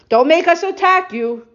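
threatening. Retold or imitated. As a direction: warn them off, menacing. Bnei Dan threaten Micha.

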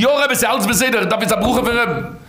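acted eager answer, pitched high; imitating an over-eager petitioner; eager reply. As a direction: answer the Rebbe in a high, eager rush of assurance.

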